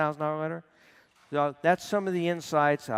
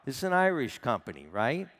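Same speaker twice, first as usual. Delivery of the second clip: usual speaking voice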